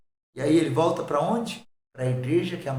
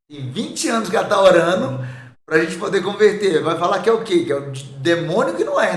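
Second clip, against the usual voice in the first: exasperated rant; 3 semitones higher, 7 dB louder than usual. Retold or imitated. Retold frustrated rant about mother.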